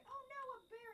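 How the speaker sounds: high, loud fake panic; mock alarm. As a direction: cry out as if a bear appears. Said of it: "literally say".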